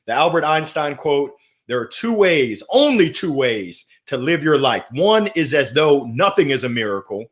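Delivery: ordinary talk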